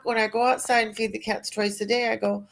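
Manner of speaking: ordinary delivery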